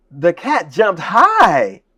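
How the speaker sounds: loud, high, drawn-out delight; be happy from the very first word. Shown as a demonstration. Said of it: "it's gotta be here first".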